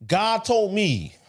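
drawn-out American preacher imitation; American accent. Shown as a demonstration. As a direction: put on an exaggerated American accent. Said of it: "I start speaking to you in American accent".

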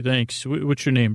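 ordinary delivery

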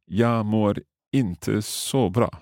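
Jag mår inte så bra, slow and careful; slow learner take. Shown as a demonstration. Learner tries the phrase slowly first. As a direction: spell each word out slowly. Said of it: "I'll try saying that quickly like you".

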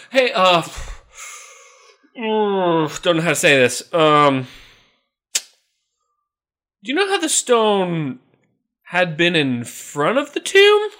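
high, loud, halting bad news; awkward subdemon. Subdemon awkwardly tells Satan about the tomb, high and halting. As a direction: stall before the bad news. Demon reports moved stone.

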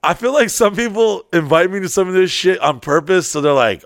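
ordinary talk

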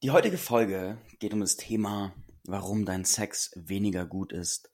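ordinary talk

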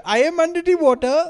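high, loud put-on Thai accent; mock Thai accent. Mimicking the Thai go-kart worker in a loud, high Thai accent. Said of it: "mock Thai accent joke".

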